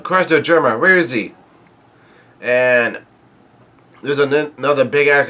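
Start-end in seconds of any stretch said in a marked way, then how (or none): none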